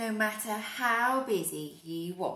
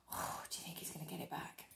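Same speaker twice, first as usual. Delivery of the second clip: ordinary delivery, nothing marked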